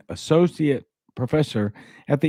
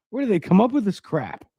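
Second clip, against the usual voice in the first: quick, higher scoff at the jargon; scoffing disbelief; 8 semitones higher, faster than usual. Real, not acted. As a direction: toss off a fed-up jab at the science. Scoffing at the galaxy name.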